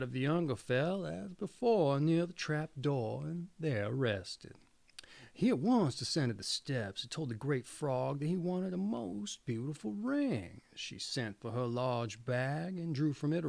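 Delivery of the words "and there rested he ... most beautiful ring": plain reading voice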